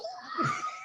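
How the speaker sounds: high, thin burst of laughter; real high-pitched laugh. Real, not acted. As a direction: crack up after the punchline. Laughter at the joke's punchline.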